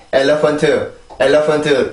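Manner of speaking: "엘리펀트" in four even Korean syllables; wrong Korean-style pronunciation. Shown as a demonstration. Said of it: "이게 아니라".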